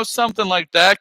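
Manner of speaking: ordinary talk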